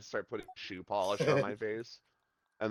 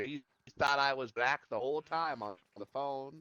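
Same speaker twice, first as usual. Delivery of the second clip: normal talking voice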